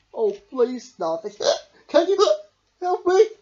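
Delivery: high, loud plea broken by gasps; pleading between hiccups. A narrator's reading. Smiley Shark begging Starfish for help, hiccuping. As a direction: beg for help while hiccuping. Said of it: "asked Smiley Shark".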